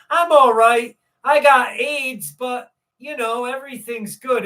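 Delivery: put-on matter-of-fact kid, starting loud then easing off; imitated little boy. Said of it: "he said it just like that".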